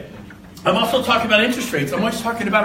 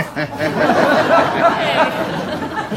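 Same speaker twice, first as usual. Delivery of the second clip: hearty laugh; 7 semitones higher, 4 dB louder than usual. Real, not acted. Laughing at the story.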